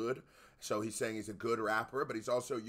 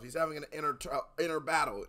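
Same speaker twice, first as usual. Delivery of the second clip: ordinary talk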